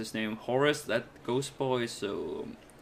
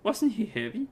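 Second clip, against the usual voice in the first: put-on much higher, doting old lady fussing over her cat; 10 semitones higher than usual. Old Miss Layla greeting her returned cat, much higher and doting. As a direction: coo it like a flustered granny.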